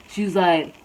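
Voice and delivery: usual speaking voice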